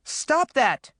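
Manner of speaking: high-pitched shouted command. A narrator's reading. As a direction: shout it to make her stop. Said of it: "Maureen shouted".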